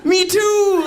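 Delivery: awkward laughing me too; laughing agreement. Retold or imitated. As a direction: laugh it out up high. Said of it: "and I'm like".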